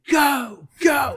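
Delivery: high shouted go; crowd shouting. Retold or imitated. Audience shouting at the Marine to go on, high and loud. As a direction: yell it like a cheering audience.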